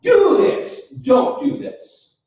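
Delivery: high-pitched emphatic command, each order set apart; example command. Shown as a demonstration. Lecturer giving examples of imperative statements, commanding. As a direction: issue two curt example orders. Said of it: "It's a command".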